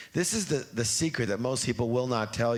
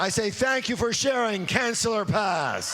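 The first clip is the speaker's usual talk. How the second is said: loud declaration; 9 semitones higher than usual. Shown as a demonstration. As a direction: shout it out, strong and high. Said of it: "please stand up for the declaration".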